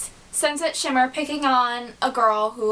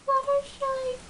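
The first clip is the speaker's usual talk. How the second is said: "Fluttershy" squeaked much higher and softer; a timid little squeak of the name; shy Fluttershy; 4 dB quieter than usual. Retold or imitated. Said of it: "she talks like this".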